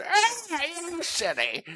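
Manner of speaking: put-on very high, strained old man; wizened old man voice. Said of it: "my wizened old man voice".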